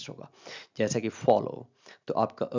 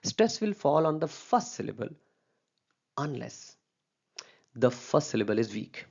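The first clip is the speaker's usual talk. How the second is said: slow rule dictation; 2 semitones higher, much slower than usual. Acted. Teacher dictating the stress rule for nouns to the class, very slow. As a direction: read the rule word by word, very slowly.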